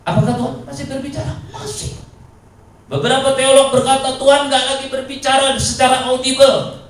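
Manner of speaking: loud, high preaching. Acted. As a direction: drive the point hard. Preaching at full force.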